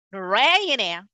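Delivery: Narayana with a rising stressed syllable; wrong English stress. Shown as a demonstration. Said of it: "So they either say".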